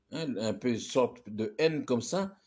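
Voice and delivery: ordinary talk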